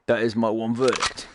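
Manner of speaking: usual speaking voice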